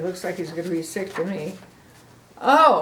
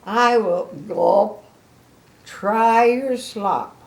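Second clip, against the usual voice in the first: slow, louder, a hard gulp on "gulp"; reluctant gulp; 2 semitones higher, 6 dB louder, slower than usual. Shown as a demonstration. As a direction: gulp it out as if swallowing fear. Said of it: "Gulp means he doesn't want to".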